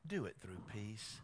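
soft, low, calm voicing of God; gentle advice. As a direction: say it hushed and calm, like a quiet counsel. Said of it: "he's saying".

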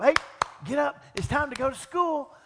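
loud high call up the stairs; waking-up shout. Retold or imitated. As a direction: shout the kids awake. Father waking kids for school.

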